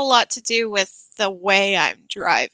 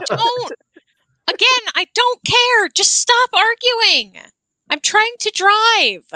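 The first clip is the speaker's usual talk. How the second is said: put-on refereeing kids in car; 10 semitones higher, 3 dB louder than usual. Parent driver scolding kids.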